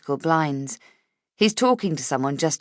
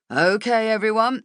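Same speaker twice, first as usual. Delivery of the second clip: raised voice, calling the office together; 6 semitones higher, 4 dB louder than usual. A narrator's reading. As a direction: call everyone in, brisk and loud. Boss calling staff to meeting.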